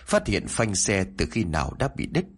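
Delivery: ordinary delivery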